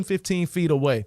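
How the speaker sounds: usual speaking voice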